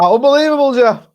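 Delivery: loud, high, excitable pundit catchphrase; hammed-up mock commentator's exclamation; Chris Kamara. Co-host mimics Chris Kamara's reaction to the groin story, loud impression. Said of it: "Was he like".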